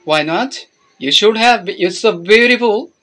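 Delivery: normal talking voice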